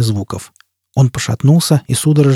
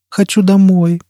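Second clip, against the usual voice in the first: put-on high child's whine; small voice, pitched well up; whimpering child; 7 semitones higher, slower than usual. A narrator's reading. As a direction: plead like a tearful little child. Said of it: "скулил Зигурд".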